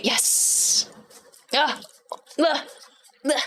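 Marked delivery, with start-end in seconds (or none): none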